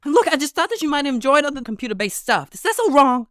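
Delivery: high-pitched, pleading character voice; small, high indignant voice; defensive young gamer. Acted. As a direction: protest that no harm was meant.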